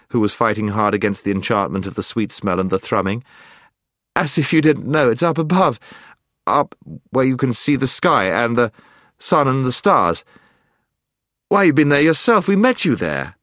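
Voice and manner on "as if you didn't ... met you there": loud, high indignation; indignant protest. A narrator's reading. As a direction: protest hotly. Scrub protests hotly.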